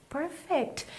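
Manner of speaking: gentle little aside; soft praise. Real, not acted. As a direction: quietly praise the learner. Teacher softly praises students' repetition.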